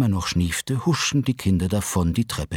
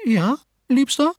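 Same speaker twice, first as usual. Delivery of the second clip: much higher, sugary wife; Mrs Willoughby's voice; 4 dB louder than usual. A narrator's reading. Wife answers husband, high and sugary. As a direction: coo it sweetly.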